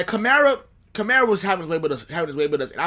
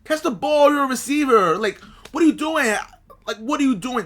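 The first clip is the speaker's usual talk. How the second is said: higher and louder, scolding the tight end; exasperated yell at player; 7 semitones higher, 4 dB louder than usual. Retold or imitated. Fan scolding his tight end through the TV, exasperated yell. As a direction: bark it at the player like he can hear you.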